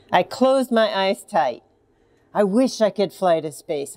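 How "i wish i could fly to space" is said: usual speaking voice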